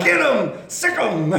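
loud, high egging-on; rousing mock attack command; urging yell. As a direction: shout to set the dog on. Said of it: "A good place to amen is like".

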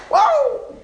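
very high, loud whoa; excited whoop. Acted. Preacher joking about kissing his wife, excited whoop.

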